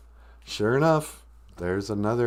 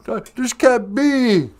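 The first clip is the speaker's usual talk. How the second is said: mock crying; 11 semitones higher, 5 dB louder, much slower than usual. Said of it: "I started to cry".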